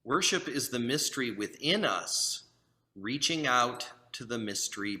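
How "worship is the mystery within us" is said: normal talking voice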